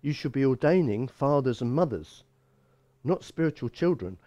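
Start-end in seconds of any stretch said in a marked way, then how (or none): none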